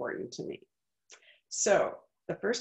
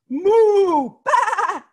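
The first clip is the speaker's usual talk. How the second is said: much higher, loud moo and baa; animal shouts; 13 semitones higher, 13 dB louder than usual. Acted. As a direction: bellow the animal noises to wake him. Animals rock the bed.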